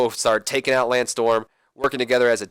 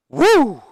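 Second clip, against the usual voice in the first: loud woo; 13 semitones higher, 8 dB louder than usual. Retold or imitated. Kurt Angle's woo.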